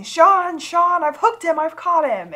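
high, loud excited yell calling a name; retold screaming. Old captain yelling to Sean that he's hooked the shark, loud and high. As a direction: shout it out across the boat like he's landed a big one. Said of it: "We hear Captain screaming".

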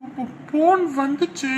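embarrassed stammer. Acted.

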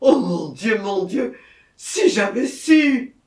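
loud outcry rising to a high wail; terrified cry. A narrator's reading. Murderer gripped by frightful terror, crying out. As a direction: lament it in dread and regret. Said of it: "en proie à la plus effroyable terreur".

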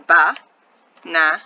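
Ba Na Na with a pause after each; syllable by syllable. Shown as a demonstration. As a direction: count out Ba, Na, Na as separate beats. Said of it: "how many syllables does this word".

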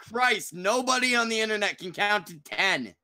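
high, loud yell of disbelief; exasperated shouting. Real, not acted. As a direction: shout it out, fed up with everyone. Exasperated outburst over crowded call.

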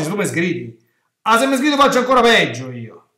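high, loud stubborn retort. Acted. Scolded beggar answers his scolders, loud and defiant. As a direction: shout back, refusing to be hushed. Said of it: "Lui continua ancora più forte".

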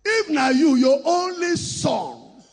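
drawn-out shouted pastoral demand; preaching at full force. Real, not acted. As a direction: hammer each word home to the congregation. Preacher pressing a congregant.

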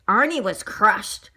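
plain reading voice

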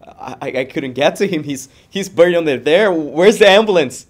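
building to a high, desperate shout; stammer, then scream for help; frantic yelling. Acted. Friend of a crash victim demanding an ambulance, frantic yelling.

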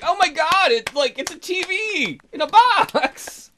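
high, loud, slow astonished cry; mock amazed. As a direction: gasp like a kid seeing a TV for the first time. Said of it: "He's like".

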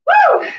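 high whooping cheer; excited fitness whoop; cheer woo. Real, not acted. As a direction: whoop to pump up the class. Coach cheering after set.

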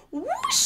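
high rising whoosh. Acted. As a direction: swoop up like a hoverboard. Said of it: "and go".

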